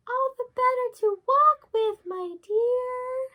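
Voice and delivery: high, slow put-on granny voice; a wolf squeaking like a sweet old granny; wolf faking grandmother. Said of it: "disguise himself as the grandmother".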